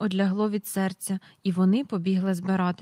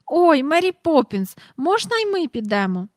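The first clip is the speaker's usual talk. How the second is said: eager impatience; 7 semitones higher, 5 dB louder than usual. A narrator's reading. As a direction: beg excitedly like an impatient girl. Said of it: "аж заскакала з нетерплячки".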